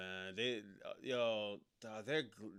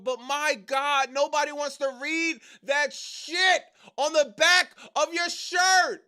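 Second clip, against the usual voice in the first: from raised to very high, loud yelling; building incredulous rant; 10 semitones higher, 7 dB louder than usual. Real, not acted. Streamer mocking the wrestler's shirt, building to a high shout. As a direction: build the disbelief until you're shouting.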